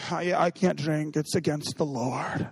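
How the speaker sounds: hammed-up sorrowful piety, dragged out; mock pious lament. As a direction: play the self-pitying believer who can't drink. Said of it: "some people are like".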